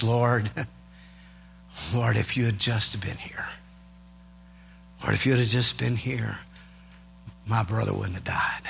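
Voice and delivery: hushed, breaking whisper at her feet; worshipful whisper. Retold or imitated. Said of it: "she said it more like this".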